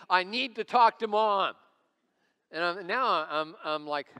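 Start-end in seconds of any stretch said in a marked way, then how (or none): 0.1-1.5 loud, high imitation of an insistent child; very upset daughter. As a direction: belt out an exasperated child's demand. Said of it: "she's really getting upset".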